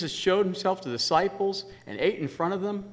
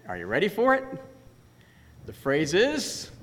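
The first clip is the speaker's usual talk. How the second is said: ordinary talk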